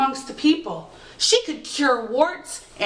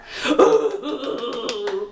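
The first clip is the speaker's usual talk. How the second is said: fairy cackle. Acted. Fairy laughs at eggshells.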